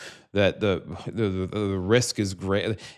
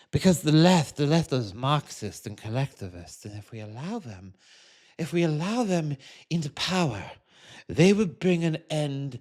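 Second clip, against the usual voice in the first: higher pompous mock conservative voice; mock Trump voter; 4 semitones higher than usual. Mocking a Trump voter who defends capitalism in a higher, puffed-up voice. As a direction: parody the voter defending Trump with self-importance.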